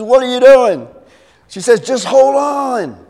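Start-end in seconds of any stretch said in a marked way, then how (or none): 0.0-0.9 high, loud cry of disbelief; alarmed shout. Acted. Acted panic at the rope. 1.8-3.0 high drawn-out yell; calling up urgently. Acted. Woman dangling calls up.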